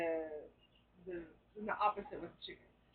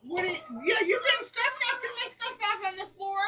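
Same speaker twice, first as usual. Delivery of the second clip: whiny scolding; 11 semitones higher, 7 dB louder than usual. Real, not acted. Scolding bird for knocking stuff.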